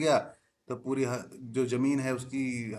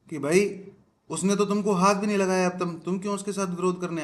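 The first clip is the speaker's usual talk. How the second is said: emphatic explaining; 5 semitones higher, 6 dB louder than usual. Shown as a demonstration. Teacher explains heatedly.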